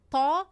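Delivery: heavy, rounded ṭ in "تا"; heavy emphatic T. Shown as a demonstration. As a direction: thicken the t of "تا" into a dark Arabic ṭā. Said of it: "با کمک زبان چه خیلی گفتیم به بالا نگاه کرده".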